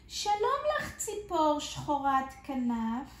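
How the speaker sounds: high, friendly gnome voice greeting a bird; cheerful little gnome. Acted. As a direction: chirp it out like a small happy gnome.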